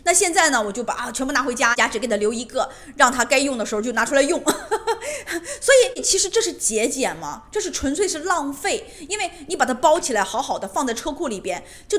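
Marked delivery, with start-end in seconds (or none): 4.5-5.6 high-pitched amused laugh; chuckling at her own story. Real, not acted. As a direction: burst into a light, high laugh.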